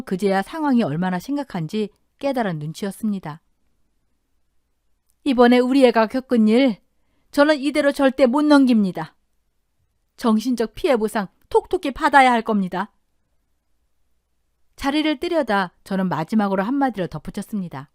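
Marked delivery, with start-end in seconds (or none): 5.2-12.9 louder, firm voiced indignation; mildly acted angry ultimatum; indignant demand. Mother warning her daughter's in-law she won't let it go, indignant.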